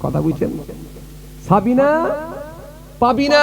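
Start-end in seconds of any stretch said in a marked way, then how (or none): 1.5-3.4 calling out. Retold or imitated. Father out with a torch, calling his daughters by name. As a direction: stretch the names out as a rising call.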